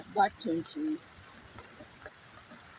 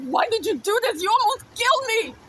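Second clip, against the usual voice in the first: much higher, loud anguished protest; frustrated outcry; 12 semitones higher, 7 dB louder than usual. Retold or imitated. Child confronting the brother who almost killed them, crying out in frustration. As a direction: cry it out in raw frustration. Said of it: "crying out of frustration".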